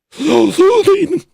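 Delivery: very high, slow, airy put-on voice; drawn out in a silly high soothing tone; inward reading voice. Shown as a demonstration. Streamer reads a chat comment in a mock soothing high voice. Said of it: "I'll read your next comment with my in loud reading voice".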